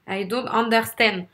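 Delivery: French-accented English, UNDERSTAND standing out. Shown as a demonstration. Coach showing the word that stands out, French accent. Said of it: "c'est le mot qui sort le plus".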